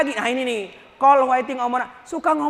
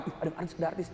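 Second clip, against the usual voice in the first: hushed excited fan; 5 semitones lower, 13 dB quieter, much faster than usual. Retold or imitated. Fan filming a passing star.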